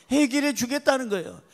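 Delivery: ordinary talk, nothing marked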